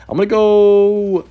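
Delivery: high, held-out final word; drawn-out stalling. Real, not acted. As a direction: stretch it out high while waiting to commit. Stalls while waiting on opponent's move.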